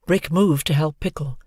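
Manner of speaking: ordinary delivery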